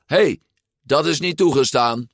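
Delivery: high-pitched, indignant objection; loud protest. A narrator's reading. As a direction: call out a cheater. Child protesting against cheating.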